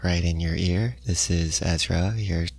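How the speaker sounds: low, soft radio host right in your ear; NPR low talking. Shown as a demonstration. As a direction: murmur it close to the mic like public radio. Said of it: "N P R style talking, like the low talking".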